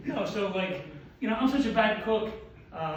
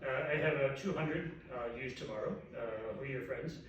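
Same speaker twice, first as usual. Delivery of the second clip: lower, softer, hesitant; a hint of a quiet friend's voice; imitating Rabiel; 6 semitones lower, 9 dB quieter than usual. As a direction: mumble it like a shy doctor. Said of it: "He goes".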